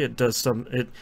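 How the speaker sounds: ordinary talk, nothing marked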